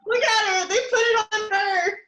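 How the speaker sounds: high, loud outraged cry; shocked shout. Real, not acted. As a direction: shout it out in disbelief. Reacting to the show, outraged.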